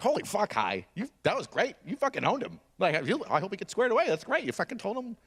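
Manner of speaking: high, gushing praise; mock-starstruck congratulation; impressed onlooker. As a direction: gush in an awed high voice. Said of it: "the guy's just like".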